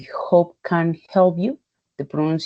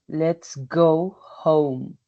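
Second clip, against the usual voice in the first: "Let's go home" drawn out, each word separate; very slow example; much slower than usual. Shown as a demonstration. Teacher modelling the oh sound for students, very slowly. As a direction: read it at half speed for learners.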